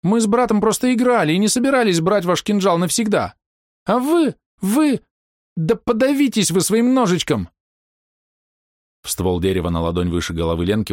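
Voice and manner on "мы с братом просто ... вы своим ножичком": put-on very high, indignant squeaky girl. A narrator's reading. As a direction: squeal it out, offended child. Обиженная девочка продолжает кричать. (писклявый обиженный детский голос)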